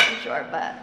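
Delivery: normal talking voice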